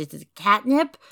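normal talking voice

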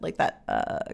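very low creaky croak on uh; vocal fry. Shown as a demonstration. Showing listeners the vocal fry, low creaky voice. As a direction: let the voice drop into a crackling fry. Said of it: "so much, like, vocal fry".

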